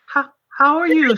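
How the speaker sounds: sweet, high voice for a tiny mouse; gentle high voice. Acted. Player cooing high at the tiny mouse.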